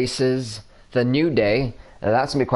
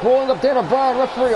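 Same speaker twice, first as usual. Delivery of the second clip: excited shout; 10 semitones higher than usual. Real, not acted. Excited play-by-play call.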